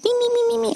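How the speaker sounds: high squeaky chipmunk chirping; mock sped-up chirping; sped-up bird chirp. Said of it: "all sped up".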